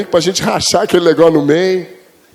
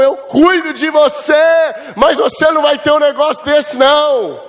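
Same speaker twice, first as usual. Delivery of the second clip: ringing high preacher's shout; a soaring pulpit shout; 9 semitones higher than usual. Retold or imitated. Quoting people, voice peaks high.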